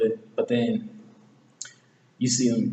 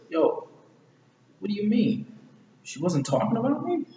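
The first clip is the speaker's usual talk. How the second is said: high, incredulous question; acted disbelief; 9 semitones higher than usual. Retelling Shrek questioning Donkey, acted disbelief. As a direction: ask it high, rising at the end.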